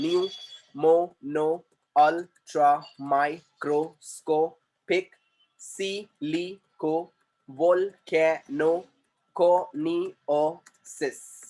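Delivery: "New, मो, नो, ultra, microscopic, silico, volcano, कोनिओसिस" read slowly in separate syllables; each syllable of "new, मो, नो, ultra, microscopic, silico, volcano, कोनिओसिस" set apart by a small gap. Shown as a demonstration. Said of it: "syllable के जरिए वो बोलना start कर सकता है".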